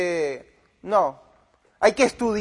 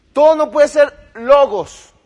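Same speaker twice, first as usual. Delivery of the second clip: loud slow mocking echo; 6 semitones higher, 9 dB louder, slower than usual. Repeating the pastor's claim.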